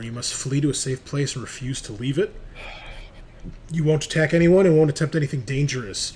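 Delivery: ordinary talk, nothing marked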